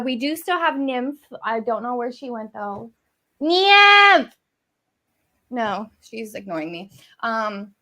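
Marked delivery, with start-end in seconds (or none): 3.4-4.3 loud call across the room, high and long; shouting the cat's name. Real, not acted. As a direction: call the cat to come. Calling the cat.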